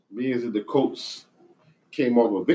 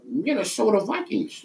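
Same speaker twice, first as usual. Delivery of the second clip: put-on Minnesota accent; 9 semitones higher, slower than usual. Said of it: "That's how they talk".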